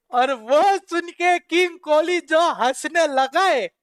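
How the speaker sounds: laughing while retelling the star's reaction. Real, not acted. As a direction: giggle as you remember the moment. Laughing while recalling Kohli's laugh.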